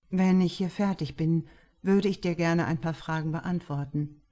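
lightly voiced composed author; calm author. A narrator's reading. Author replies to fan.

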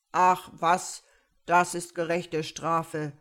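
lauter, abweisender Bär; barscher Bär. Acted. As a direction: die Bitte schroff abtun. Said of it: "sagte der Bär". (barsch abwinkender Bär)

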